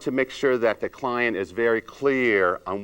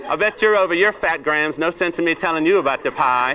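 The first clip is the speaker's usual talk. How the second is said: snide jab with a laugh breaking through; laughing mock insult; 5 semitones higher, 4 dB louder than usual. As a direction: tease through a chuckle. Rude speaker, laughing through it.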